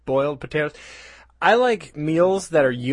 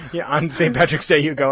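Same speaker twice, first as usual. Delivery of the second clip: ordinary talk, nothing marked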